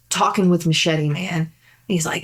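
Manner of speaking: ordinary talk, nothing marked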